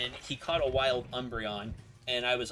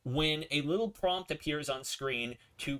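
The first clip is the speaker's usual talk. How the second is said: normal talking voice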